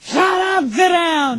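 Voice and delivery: high-pitched mock shout; mimicked yell. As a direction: parrot an angry father's order. Co-host mimics the father's taped yell.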